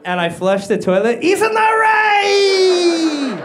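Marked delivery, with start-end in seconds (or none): 1.2-3.4 very high, loud, drawn-out dad cry; hammed-up high holler as dad; long mock shout. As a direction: wail dad's punchline, holding the last word. Imagined dad shouts as toilet flushes.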